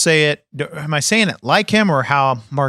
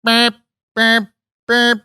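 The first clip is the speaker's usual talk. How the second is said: high, evenly spaced beeps; reversing beeps; 8 semitones higher, 4 dB louder, slower than usual. Shown as a demonstration. Showing kids' car noises, high reversing beeps. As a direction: imitate a truck backing up. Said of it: "that means backing up".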